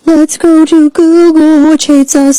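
high, put-on sing-song; silly high voice. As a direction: chirp it in a mock-sweet high pitch. Announcing a search on Google, clowning.

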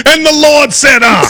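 high, sing-out Southern preacher cadence. Retold or imitated. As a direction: preach it, tacking "uh" on the end.

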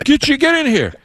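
high, urgent mock summons; New England coworker. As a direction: imitate a colleague ordering someone in. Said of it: "one of my New England coworkers".

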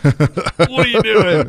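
slow, laughing while asking. Real, not acted. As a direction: laugh through the words. Teasing Bart, laughing.

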